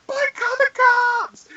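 very high, drawn-out mock shriek; imitated frantic yell. Sister-in-law yelling over the car horn, mock shriek. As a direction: screech it slowly, high-pitched.

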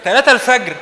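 loud, laughing disbelief; laughing outburst. Real, not acted. Preacher laughs at the congregation's late nights, loud. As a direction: laugh it out in mock shock.

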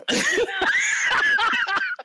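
high, loud shriek of laughter; screaming laugh. Real, not acted.

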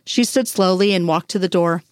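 plain reading voice

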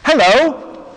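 put-on high, lilting old-lady hello; old lady's greeting.

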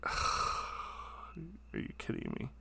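slow, breathless exasperated whisper. Retold or imitated. The singer glaring at the clueless fan, a slow whisper. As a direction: breathe it out in disbelief, slowly. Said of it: "turns to me with this glare".

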